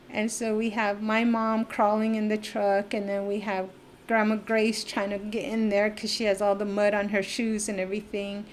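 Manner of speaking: ordinary delivery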